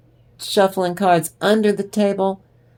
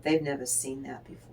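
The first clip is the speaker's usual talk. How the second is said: whisper; 4 semitones lower, 9 dB quieter, faster than usual. Real, not acted. Confiding reading aside.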